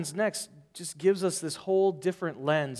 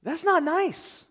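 higher, slow, mock-offended; 10 semitones higher, slower than usual. Real, not acted. Preacher reacting to Jesus calling her a dog, mock hurt. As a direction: sound wounded on behalf of the woman. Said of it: "preacher reacting to insult".

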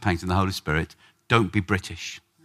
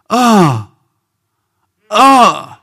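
loud, high labour groan; 6 semitones higher, 8 dB louder than usual. Shown as a demonstration. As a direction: groan as if stirred by birth pains. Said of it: "Groans and sighs".

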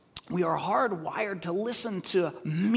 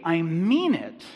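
ordinary talk

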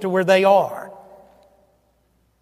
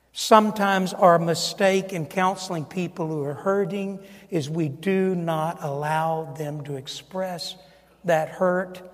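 ordinary talk

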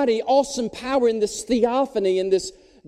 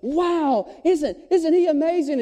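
high-pitched gushing wonder; awestruck crowd; 8 semitones higher than usual. Retold or imitated. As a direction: gush like an adoring crowd. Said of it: "the crowds just going".

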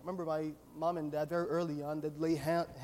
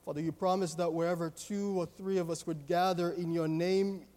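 public prayer; 3 dB louder than usual. Real, not acted. Leads the congregation in prayer.

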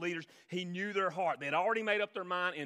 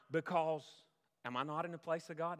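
ordinary talk, nothing marked

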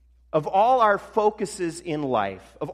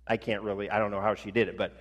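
normal talking voice

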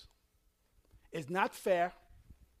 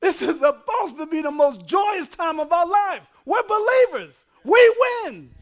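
high, loud triumphant shout; full-force preaching; 8 semitones higher, 11 dB louder than usual. Real, not acted. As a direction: preach it as a victory cry.

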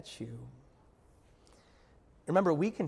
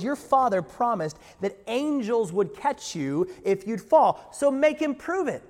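higher, louder mocking tempter's dare; taunting Satan; 6 semitones higher, 7 dB louder than usual. Preacher voicing Satan daring Jesus, taunting. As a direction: goad him like the devil twisting scripture. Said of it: "He says".

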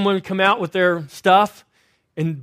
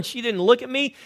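usual speaking voice

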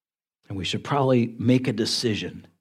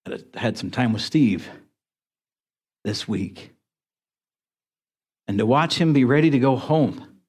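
teary-eyed. Real, not acted. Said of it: "getting a little teary-eyed".

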